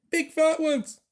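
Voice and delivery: put-on a little boy's high, loud shout. A narrator's reading. Little boy warning about the mice, high loud shout. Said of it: "shouted a little boy".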